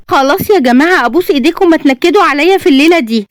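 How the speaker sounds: high-pitched, fed-up begging; exasperated pleading. A narrator's reading. Bride begs friends not to spoil tonight, exasperated pleading. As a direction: beg them to drop it, voice high.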